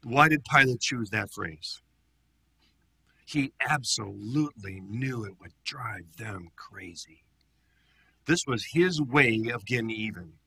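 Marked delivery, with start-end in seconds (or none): 3.2-7.2 hushed aside to the congregation; whisper. Real, not acted. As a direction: drop to a secretive whisper. Whispered aside about Pilate's motive.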